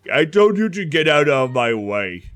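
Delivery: loud, exasperated reprimand; scolding ogre. Acted. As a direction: scold loudly as the impatient ogre. Ogre scolds Dermot after shoving him.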